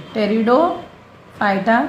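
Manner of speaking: Pteridophyta drawn out slowly, starting on T; silent P. Shown as a demonstration. Teacher has class repeat Pteridophyta slowly, P silent. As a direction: slowly model Pteridophyta without sounding the P. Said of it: "पी silent है".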